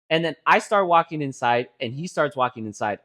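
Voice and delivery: ordinary talk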